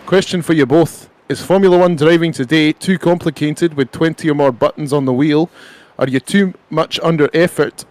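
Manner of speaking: lively Scottish read-aloud; Scottish accent. Shown as a demonstration. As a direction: read the question out as a Scot. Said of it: "I read it in a Scottish accent".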